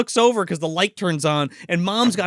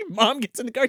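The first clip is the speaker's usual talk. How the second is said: ordinary talk, nothing marked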